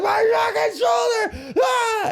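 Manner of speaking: high, slow, drawn-out yell of pain; retold pained yell. Man clutching his dislocated shoulder, drawn-out high pained yell. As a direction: howl it like the arm just popped out. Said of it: "I'm like".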